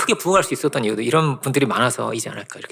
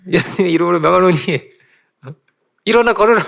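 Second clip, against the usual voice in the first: commanding proclamation; 3 semitones higher, 4 dB louder than usual. Retold or imitated. Nurse commands the paralysed man.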